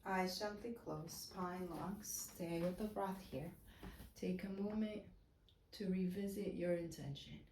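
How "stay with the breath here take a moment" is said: ordinary delivery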